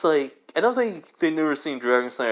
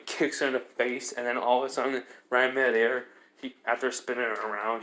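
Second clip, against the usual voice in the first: ordinary delivery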